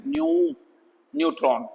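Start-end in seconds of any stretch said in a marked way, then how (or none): 0.1-0.5 न्यू with a long, high oo; high, drawn-out न्यू; long oo sound. Shown as a demonstration. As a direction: hold the vowel in न्यू as a long oo. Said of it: "इसको हम बड़े ऊ में भी ले सकते हैं".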